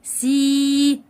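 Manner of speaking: سي held long as "seeee"; elongated syllable. Shown as a demonstration. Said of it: "بيمد الحرف اللي قبليه".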